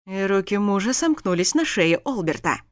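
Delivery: usual speaking voice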